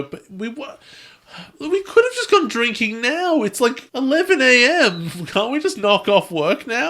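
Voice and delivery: high-pitched mock whine of complaint; protesting slacker writers. Show's writers caught out by the host, high-pitched protest. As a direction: plead to skip work, voice way up high.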